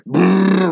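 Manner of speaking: mimicking a Jeep engine revving; engine brrr. Jeep jumping off the road, revving engine noise. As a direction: growl it out like an engine. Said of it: "go like".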